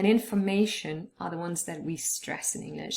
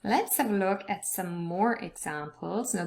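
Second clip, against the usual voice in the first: ordinary talk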